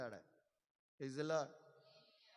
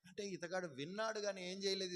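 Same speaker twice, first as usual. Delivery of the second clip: usual speaking voice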